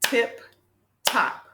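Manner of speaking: "Tip", a clear pause, then "top"; one isolated beat each for "Tip" and "top"; clapped-out syllables. Shown as a demonstration. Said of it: "can you clap out the word".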